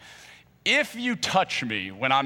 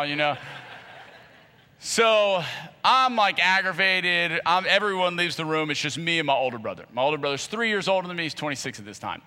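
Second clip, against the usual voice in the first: ordinary talk, nothing marked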